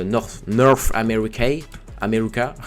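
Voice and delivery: French speaker showing off an English accent; playful attempt at an English accent. Shown as a demonstration. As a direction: say North America in a put-on English accent. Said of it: "Notez mon accent anglais".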